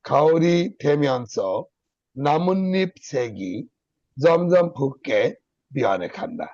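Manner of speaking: খাওরি থেমে আনছ, নামন্নিপ সেগি, জমজম ফুককে, বিয়ানে খান slow with a stop after each; four clear pauses. Shown as a demonstration. Teacher showing students where to pause, slow and deliberate. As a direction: read slowly, pausing only at the four breaks. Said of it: "আমি যখন পড়তাম".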